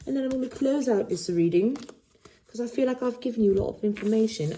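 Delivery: lightly voiced, like someone else is talking; odd put-on voice. Reader catches herself using a put-on voice. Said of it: "I don't know why I'm talking like that".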